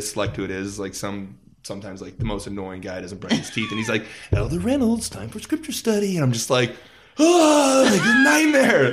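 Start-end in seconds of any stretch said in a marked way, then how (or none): 4.3-6.2 high, nagging wake-up call from the pesky roommate; mock annoying companion. Roommate voiced waking him up. 7.2-8.9 loud, high, laughing cry of despair; laughing mock groan. Reacting to the wake-up.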